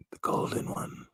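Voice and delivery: hushed, voiceless whisper; whispered echo. Pretend. Echoes the name in a whisper.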